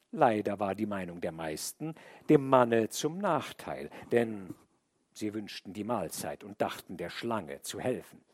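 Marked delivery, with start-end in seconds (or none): none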